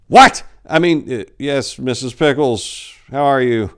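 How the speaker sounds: jolted high then recovering politely; startled priest. A narrator's reading. Priest answering the phone, startled then polite. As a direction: caught off guard, then polite.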